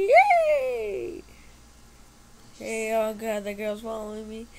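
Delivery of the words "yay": high, loud joyful squeal; excited cheer. Real, not acted. Kid on the ride cheering with glee. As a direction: cheer like the ride just began.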